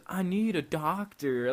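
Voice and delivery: high, stretched-out cry for help; imitated urgent plea. Friend runs in the hospital, imitated urgent plea. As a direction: re-enact calling out for help, drawing it out.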